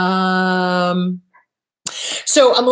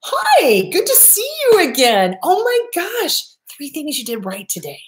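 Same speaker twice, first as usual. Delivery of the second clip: much higher, sing-song delighted greeting; cheerful loving parent; 8 semitones higher than usual. Shown as a demonstration. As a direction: greet your child like you're thrilled to see them. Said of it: "how would a healthy parent speak to you?".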